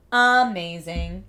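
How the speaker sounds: Amazing drawn out slowly and loudly with a rising end; slow, loud, delighted Amazing; excited. Real, not acted.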